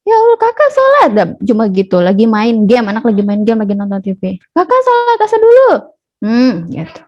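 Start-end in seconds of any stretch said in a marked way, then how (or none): 0.0-1.1 high-pitched, nagging mother's call. Acted. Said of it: "teriak-teriak ya". 4.5-5.7 high nagging call to a distracted child; mother calling out. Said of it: "teriak-teriak ya".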